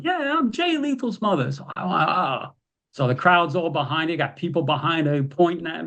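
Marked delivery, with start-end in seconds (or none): 0.0-1.5 high put-on mother's voice, indignant; imitating the mother, high and proud; indignant mom. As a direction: stand up for your son like a proud mom. Mom answers the heel.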